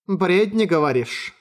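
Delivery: ordinary delivery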